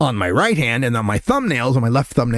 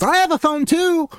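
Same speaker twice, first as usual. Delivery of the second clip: high-pitched child imitation; little girl. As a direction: pipe up proudly like a small kid. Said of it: "She says".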